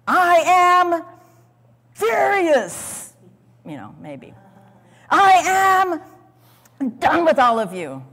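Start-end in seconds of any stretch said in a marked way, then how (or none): 0.1-3.1 high, loud furious cry; imagined furious outburst. Pretend. Preacher voicing Joseph raging at his brothers, loud high outburst. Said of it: "Surely they expected something else". 5.1-8.0 loud, high start then a hard finish; imagined angry dismissal. Pretend. Preacher voicing Joseph dismissing his brothers, loud angry. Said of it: "Surely they expected something else".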